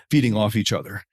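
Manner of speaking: ordinary talk, nothing marked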